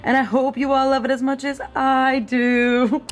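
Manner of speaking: lightly drawn-out sponsor pitch; a hint of an ad voice; ad read. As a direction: sell it like a gentle commercial. Product plug read.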